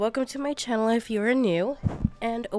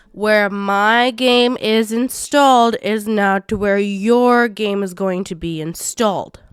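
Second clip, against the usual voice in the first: slower and louder, every word pressed; emphatic repeat; 6 dB louder, slower than usual. Shown as a demonstration. Repeating the install warning to viewers, slow and emphatic. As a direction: spell it out slowly so nobody misses it. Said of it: "Let me repeat that".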